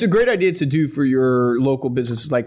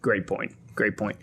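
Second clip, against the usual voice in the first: lower, softer repeated praise; softer murmured echo; 6 semitones lower, 8 dB quieter than usual. Real, not acted. Host quietly echoing the guest's point, softly. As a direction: repeat it softly to yourself, nodding along. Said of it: "That's awesome, man".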